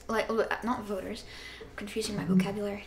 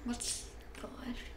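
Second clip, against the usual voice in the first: whispering; 12 dB quieter than usual. Real, not acted. Browsing stream settings.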